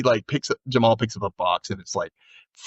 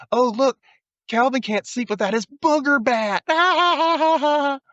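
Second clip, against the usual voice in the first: high, mocking kid voice ending in a cackle; mocking taunt; 14 semitones higher than usual. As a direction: taunt him in a high sing-song, then laugh. Tina mocking Calvin.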